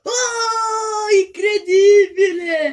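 amazed, loud and slow; excited shout. Real, not acted. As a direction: shout out in disbelief. Scoring a bottle flip.

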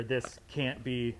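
ordinary delivery

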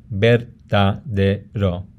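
verdadero slowly split into ver-da-de-ro; syllable by syllable. Shown as a demonstration. Teacher shows where syllables end, syllable by syllable. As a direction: pull verdadero apart into four syllables. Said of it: "how you break up a word".

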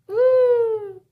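excited cheer for capes. Acted. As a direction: whoop with joy.

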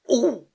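sudden high, loud "ooh" of pain; pained squeak. Acted. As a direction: yelp as a muscle twinges. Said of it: "he squeaked".

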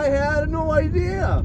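high, drawn-out denial; exasperated protest. Real, not acted. Passenger denying blame for the ride, exasperated and drawn-out.

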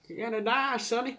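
high-pitched mock threat; imitated threatening old lady. Old lady with shotgun to boys, mock threat. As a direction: put on a menacing granny voice.

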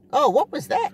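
very high mock squeak of the passenger; imitating a passenger's squeaky question; squeaky woman impression. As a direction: ask it in her tiny high voice. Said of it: "high pitched, nasally, squeaky voice".

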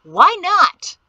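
high, loud holler; hollering back. A narrator's reading. Son hollers back at his father, loud and high. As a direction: yell it right back at him. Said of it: "Peto hollered back".

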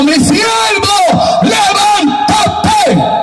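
booming divine command, high and drawn out; God. Retold or imitated. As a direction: call out slowly as if from heaven. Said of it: "oí la voz de Dios". (voz de Dios clamando, alargada)

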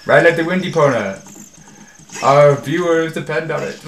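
loud, mock-heroic rallying cry; hammed-up battle cry; heroic call. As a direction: spur the horse on theatrically. Rallying the horse for the viewers.

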